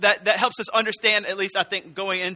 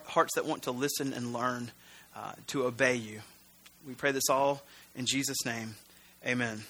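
prayer; 4 semitones lower than usual. Real, not acted. Preacher asking God to teach the congregation, prayerful. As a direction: pray simply, with halting pauses.

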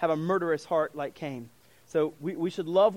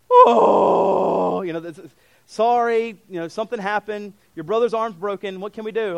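mock parent; 2 semitones higher, 9 dB louder than usual. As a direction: play the parent announcing the broken arm, hammed up. Parent voicing bad news to child.

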